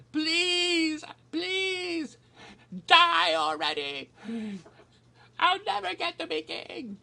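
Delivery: squeaky high falsetto whine, pleading; Charles impression. Charles begging his mother to die, in a high falsetto whine. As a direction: beg like a desperate heir stuck waiting. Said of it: "And, and Charles saying".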